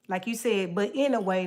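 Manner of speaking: ordinary delivery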